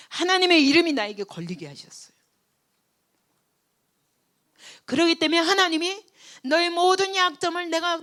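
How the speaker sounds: full-force pulpit preaching. Acted. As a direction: thunder it out to the congregation.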